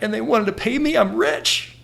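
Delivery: high-pitched acted elation; overjoyed. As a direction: marvel like a kid who struck it rich. Acts out his overjoyed younger self.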